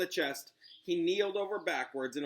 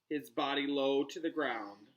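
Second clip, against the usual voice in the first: ordinary delivery, nothing marked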